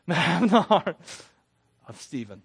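loud laugh breaking into the words. Real, not acted. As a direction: get it out through a burst of laughter. Laughs, then retakes the prayer line.

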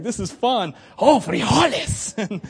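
drawn-out frijoles in a playful chihuahua voice; a light chihuahua voice. Retold or imitated. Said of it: "I'm doing voices".

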